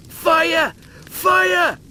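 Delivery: high, loud frantic shouts; panicked yelling. Acted. Lister screams while hunting the extinguisher, frantic yell. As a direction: bellow for help in panic.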